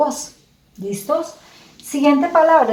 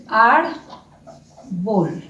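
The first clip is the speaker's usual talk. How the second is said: "Árbol" slowly as ár... bol; syllable by syllable. Shown as a demonstration. Teacher sounds out the pictured word, syllable by syllable. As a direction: split "Árbol" with a clear gap. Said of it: "pronunciar por sílabas".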